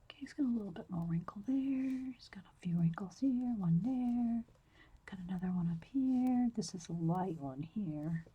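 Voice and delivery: soft whisper, close to the mic. Real, not acted. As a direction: whisper as you draw. Whispers while drawing.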